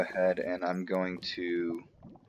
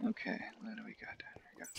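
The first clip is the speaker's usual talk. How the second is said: whispering; 17 dB quieter, faster than usual. Real, not acted. Whispering while exploring.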